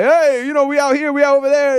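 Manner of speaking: high, loose attempt at an Australian accent; bad Australian accent. Podcast guest imitating Australians he met, bad Australian accent. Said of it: "That was a horrible accent".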